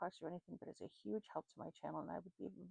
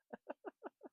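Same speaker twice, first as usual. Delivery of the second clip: high giggle; 13 semitones higher, 4 dB quieter than usual. Real, not acted. Laughing at her slip.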